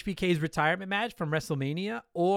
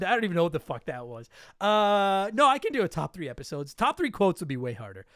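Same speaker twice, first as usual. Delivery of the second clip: usual speaking voice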